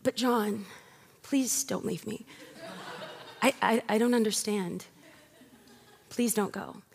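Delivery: hammed-up soap actress begging John; soap opera plea. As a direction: play a mock-tender American soap breakup. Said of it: "look at her forlorn lover ... and say".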